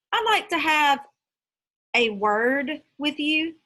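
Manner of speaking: "word" leaned on, slow and stern; teacher pressing the word "word"; stress on word. Acted. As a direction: lean hard on "word" as a warning. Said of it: "she emphasized the word word".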